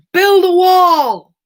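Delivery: loud, high, drawn-out chant; big hammed-up shout, stretched out; Cheeto impression. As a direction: bellow it slowly like a rally slogan. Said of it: "That was my Cheeto impression".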